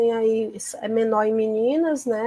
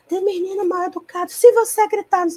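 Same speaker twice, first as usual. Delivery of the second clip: higher and louder, a hammed-up scolding yell; mock yelling teacher; 9 semitones higher, 3 dB louder than usual. Teacher showing how not to yell at a pupil, mock shout. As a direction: act out the wrong way, snapping at a child. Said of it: "você não vai alterar a voz".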